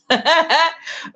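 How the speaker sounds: high, light giggle; short burst of amused laughter. Real, not acted. Host tickled by own squirrel joke, giggling.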